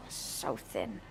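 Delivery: breathy whispered so thin. Acted. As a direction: whisper it to yourself. Whispered aside about the cabbage.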